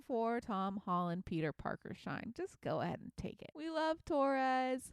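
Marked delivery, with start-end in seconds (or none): 3.5-4.9 fangirl gush. Real, not acted. Fan gushing over Torres.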